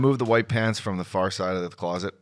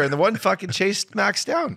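ordinary talk

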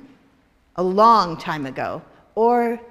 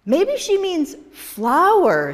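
slow, high, louder put-on voice; corner shop man; 8 semitones higher, 6 dB louder, much slower than usual. The corner shop man guesses at Vivian's meaning, slow and high. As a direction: stretch it out in a silly high voice.

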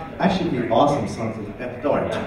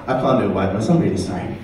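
ordinary talk

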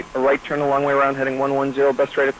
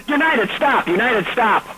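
urgent; 7 semitones higher than usual. Real, not acted. Controller halts a moving jet.

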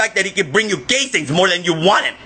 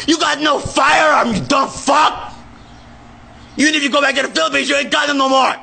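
furious shout; 4 semitones higher than usual. Real, not acted. Screaming insults at his dad.

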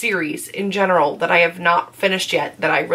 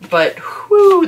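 big exhale; 11 semitones higher, 6 dB louder than usual. Real, not acted. Overwhelmed by tearjerker books.

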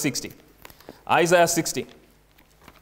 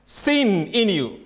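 "Seen" held long, then falling; slow, deliberate emphasis on "Seen"; stress on seen; 2 semitones higher, much slower than usual. Shown as a demonstration. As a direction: stretch "Seen" out and let it drop. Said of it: "Is that how you emphasize?".

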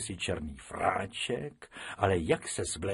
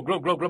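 higher, bubbly gurgle; a drowning rabbit gulping water; gurgling; 6 semitones higher, 5 dB louder, faster than usual. Acted. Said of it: "místo volání o pomoc se ozývalo jenom Glo". (bublavé kloktání)

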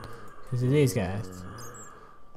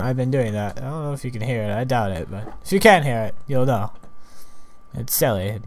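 ordinary talk

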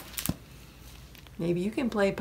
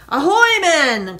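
high, loud pirate hail; gleeful cry; 8 semitones higher, 11 dB louder, slower than usual. A narrator's reading. Jack spots a ship and hails his men, gleeful cry. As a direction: hail the crew with pleasure. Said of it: "cried Jack with a chortle of pleasure".

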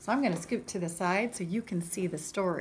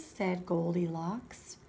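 ordinary delivery

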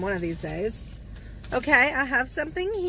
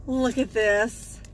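normal talking voice

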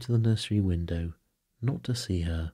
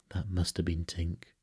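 ordinary delivery

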